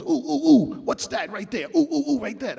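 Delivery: high-pitched imitation of an excited label boss; mock excited executive. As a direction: squeal "ooh" like you just spotted something. Mimics clueless label boss hearing strings.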